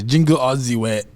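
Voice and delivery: mock Austrian accent; Arnold Schwarzenegger. Shown as a demonstration. Defending his impersonation to his friend, in a mock Austrian accent. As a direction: demonstrate a hammed-up Austrian accent. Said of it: "my Arnold impersonation is".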